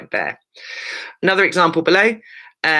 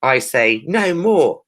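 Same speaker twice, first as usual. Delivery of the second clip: NO MORE stretched out slowly for emphasis; emphatic capitals; 3 dB louder, slower than usual. Shown as a demonstration. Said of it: "for emphasis".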